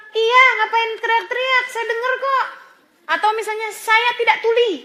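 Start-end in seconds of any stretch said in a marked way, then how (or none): 0.1-2.5 acting out an irritated retort, high and loud; balasan kesal. Acted irritated retort to shouting. 3.7-4.7 high, loud mock protest; irritated retort. Acted retort to loud family member.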